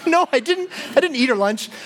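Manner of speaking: high-pitched protest, retold for laughs; defensive mock denial. Accused speaker denying eating coworker's lunch, mock defensive. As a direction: protest your innocence, voice jumping up.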